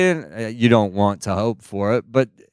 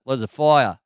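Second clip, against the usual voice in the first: Australian accent; 3 semitones higher than usual. Shown as a demonstration. Newcomer keeping the accent from home, cool Australian accent. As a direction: FIRE with Australian vowels, no R. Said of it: "you keep that cool Australian accent".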